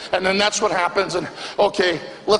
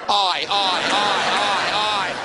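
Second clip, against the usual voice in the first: lamenting aye aye; groaning ay ay ay in dismay; Greek lament. Real, not acted.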